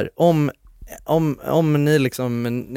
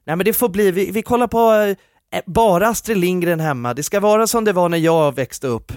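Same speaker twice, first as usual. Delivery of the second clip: higher, emphatic declaration of principle; mock idealistic parent; 5 semitones higher, 4 dB louder than usual. Podcaster plays a parent-to-be banning screens, mock-resolute. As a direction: proclaim the house rules with naive conviction.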